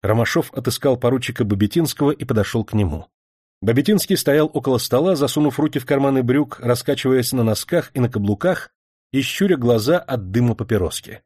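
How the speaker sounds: plain reading voice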